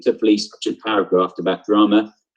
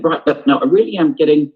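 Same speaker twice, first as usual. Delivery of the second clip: frustrated, pushed a little louder; 6 semitones higher, 4 dB louder than usual. Shown as a demonstration. Teacher demos John Tate's first line, mildly frustrated. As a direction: let the frustration leak into it. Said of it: "I'm gonna be quite frustrated when I'm saying".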